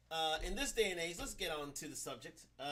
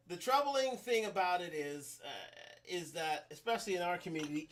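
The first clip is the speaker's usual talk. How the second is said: usual speaking voice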